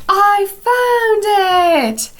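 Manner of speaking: put-on excited little boy's hooray; happy cheer. A narrator's reading. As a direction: celebrate finding the ball. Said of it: "Henry says".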